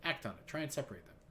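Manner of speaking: usual speaking voice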